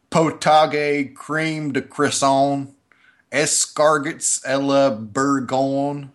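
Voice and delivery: slow drawn-out Tennessee reading of French; Tennessee accent. Shown as a demonstration. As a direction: mangle French words Southern style, slowly. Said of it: "I can read it in a Tennessee accent".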